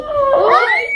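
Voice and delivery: sudden high shout of disgust; shrill scream. Real, not acted. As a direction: yelp it out. Reacting to the disgusting paper.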